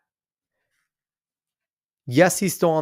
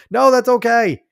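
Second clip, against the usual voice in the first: high, loud burst of reassurance; loud, high-pitched exclamation; emphatic reassurance; 10 semitones higher, 8 dB louder than usual. Real, not acted. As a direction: call it out loudly, waving off an apology. Brushing off a commenter's apology.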